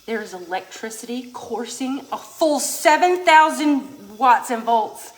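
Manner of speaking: slow, booming announcement; loud dramatic hype. Pretend. Hyping the hot dog electrocution, loud and dramatic. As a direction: build to a loud, drawn-out proclamation.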